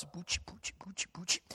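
breathy swooshes mimicking swinging swords; whooshing weapon noises. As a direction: swish the air like clubs swinging. Imitating swords and clubs swinging.

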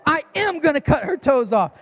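mock-furious little boy insisting back at dad; defiant child. Son defying his father's warning, imitated angry shout.

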